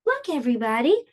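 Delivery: plain reading voice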